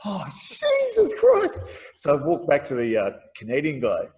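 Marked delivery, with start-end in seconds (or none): 0.1-1.6 slow, much higher, drawn-out "oh Jesus Christ"; exasperated relief. Retold or imitated. Student relieved that he was not expelled, slow and high. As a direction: stretch it out, high and slow, as the tension drains away.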